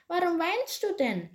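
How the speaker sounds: high-pitched dwarf voice; bright little voice. A narrator's reading. Forest dwarf asks crying Peter why, in a bright high voice. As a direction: ask it high and light, in a bright voice. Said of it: "eine helle Stimme hörte".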